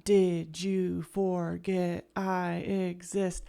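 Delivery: slow, spaced-out "Did you forget I exist?"; slow rhythmic quote. Retold or imitated. Showing viewers the song's rhythm, slowly. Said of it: "the way that rhythm".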